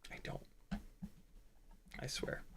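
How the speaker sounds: whisper. Acted. Joking aside to missed viewer.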